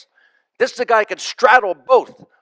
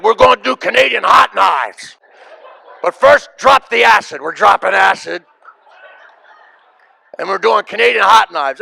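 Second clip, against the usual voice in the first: hyped-up announcement; 2 semitones higher, 6 dB louder than usual. Retold or imitated. Comic friend announcing the night's plan to visitor, shouted. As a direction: shout the plan like a wild host.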